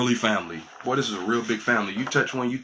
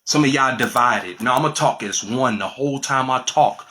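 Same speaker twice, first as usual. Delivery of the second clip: ordinary delivery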